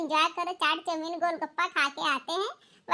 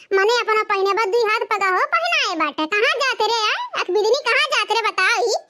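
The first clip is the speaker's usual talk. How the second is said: put-on louder, lamenting old mother-in-law; scolding mother-in-law; 3 semitones higher, 7 dB louder than usual. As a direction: wail and scold like a shocked village mother-in-law. (बहुओं को डाँटती सास, ऊँची आवाज़ में विलाप)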